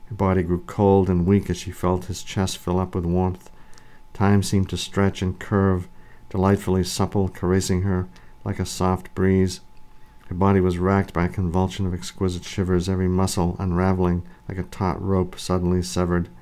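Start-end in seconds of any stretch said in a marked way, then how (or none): none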